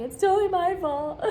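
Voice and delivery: mock cry of self-blame, sung out high; mock wail. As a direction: wail it like a melodramatic breakdown. Mocking shifting blame in divorce.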